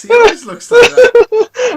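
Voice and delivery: laughing hard while talking; words squeezed out through laughter. Real, not acted. Laughing at friend's frozen video.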